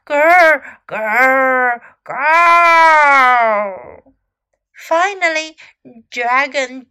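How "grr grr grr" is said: loud growls ending in a long roar. A narrator's reading. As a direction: roar it out like a monster. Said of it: "这咆哮声越来越响亮了".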